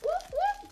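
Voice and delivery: high whooping siren sound; police siren. Shown as a demonstration. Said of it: "That's the sound of the police".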